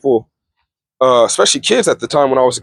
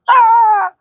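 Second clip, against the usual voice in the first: high, loud pained yelp; hammed-up cry of pain; mock yelp of pain; 3 dB louder than usual. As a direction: yelp like a kid taking a swat. Classmate being paddled.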